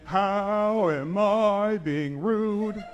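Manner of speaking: very slow, high, sing-song copy; mock slow voice. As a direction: drag it out mockingly. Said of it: "Why are you talking like that?".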